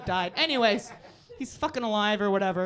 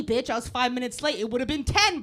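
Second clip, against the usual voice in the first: ordinary delivery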